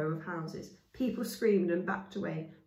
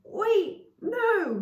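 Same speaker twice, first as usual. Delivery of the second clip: alarmed protest; 8 dB louder than usual. Acted. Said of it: "said Chaya".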